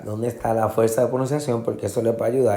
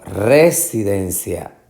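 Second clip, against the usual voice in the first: slow residencia with RE punched; misplaced first stress; slower than usual. Shown as a demonstration. Teacher repeats the options slowly, RE-sidencia. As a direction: hit RE hard in residencia. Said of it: "den es la sílaba".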